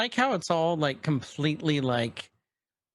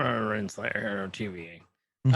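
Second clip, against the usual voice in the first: slow mock announcer; 5 semitones lower, much slower than usual. Mock announcer naming character.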